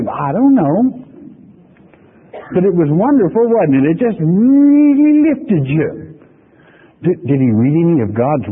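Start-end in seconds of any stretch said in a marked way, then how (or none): 0.1-5.9 gushing churchgoer. Retold or imitated. Preacher mimicking a churchgoer who can't recall the sermon, high and swooning. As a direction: gush with empty rapture, slowing at the end. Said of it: "people come and say".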